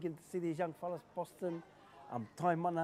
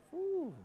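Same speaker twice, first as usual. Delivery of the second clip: high, drawn-out "ooh" of excitement; impressed ooh; 4 dB louder than usual. Real, not acted. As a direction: let out a high-pitched ooh at the play. Reacting to the live play.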